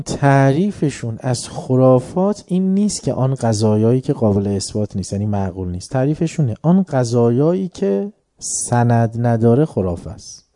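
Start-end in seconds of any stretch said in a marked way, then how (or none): none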